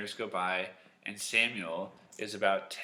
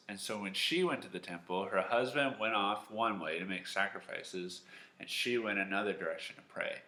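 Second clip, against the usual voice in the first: usual speaking voice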